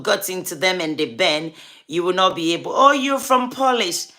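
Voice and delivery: ordinary delivery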